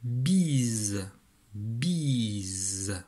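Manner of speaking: "bise" slowly stretched with a buzzed z; slow dictation of "bise", falling in pitch; dictated syllable. Shown as a demonstration. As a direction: draw out "bise", holding the voiced z. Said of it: "je veux que tu écrives bise".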